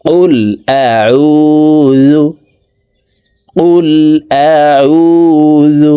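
high, very slow melodic chant; Quran recitation. Shown as a demonstration. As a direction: stretch every syllable in recitation. Recites with tajweed.

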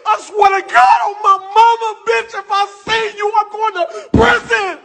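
high, tearful furious shouting; screaming threats through real tears; sobbing, furious shout at the tenant who trashed the house; crying rage. Real, not acted. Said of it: "I'm mad as a motherfucker".

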